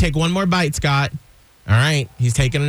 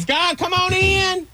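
calling out; slower than usual. Real, not acted. Calling him back into room.

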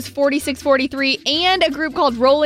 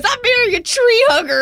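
much higher, laughing through it; laughing quote; 11 semitones higher, 3 dB louder than usual. Retold or imitated. Host quoting fiance's jab through laughter. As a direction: giggle out his teasing line. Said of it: "he goes".

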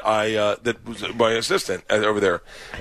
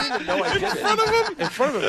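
very high, squeaky laughing words; helpless laughter; faster than usual. Real, not acted. Comic admits his blunder to co-host, helplessly laughing. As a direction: squeeze the words out through laughter. Cracking up at own confession.

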